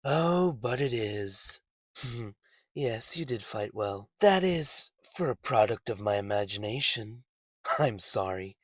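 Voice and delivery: low, very quiet villain voice; soft, calm menace. Acted. Visionary villain telling his foe he will kill him, soft calm menace. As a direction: threaten softly, barely raising the voice.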